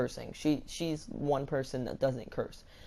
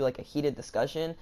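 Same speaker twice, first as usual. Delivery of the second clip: ordinary talk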